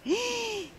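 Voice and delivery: high, sudden gasp of alarm; a sharp worried gasp on finding him; startled gasp. Acted. Family finds the fallen boy. (grito ahogado de susto)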